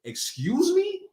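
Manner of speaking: high, incredulous rise; affronted disbelief. Acted. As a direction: act taken aback, pitch shooting up. Acting taken aback at rude reply.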